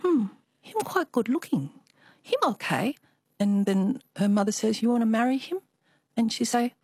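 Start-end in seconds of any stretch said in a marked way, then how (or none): 0.0-3.0 put-on higher, shy Chinese-accented young woman; coy Chinese accent, pitched up. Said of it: "I always have to do the Chinese accent". 4.8-5.6 put-on higher Chinese-accented mother; light Chinese accent, questioning. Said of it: "I always have to do the Chinese accent".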